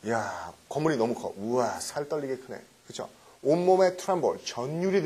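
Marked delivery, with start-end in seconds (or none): 0.0-2.6 hushed, low mock amazement; acted awe. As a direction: play at being overawed, low and soft. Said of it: "어떻게 큰 건지 아세요?".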